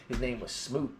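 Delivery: ordinary talk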